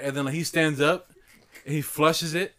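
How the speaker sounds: ordinary delivery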